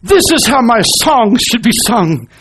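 high, loud, delighted exclamation; thrilled composer. Retold or imitated. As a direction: burst in, overjoyed at her singing. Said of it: "he says".

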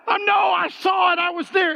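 high, loud protest; insistent shout. Real, not acted. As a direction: shout it like they must believe you. Insisting against doubting classmates.

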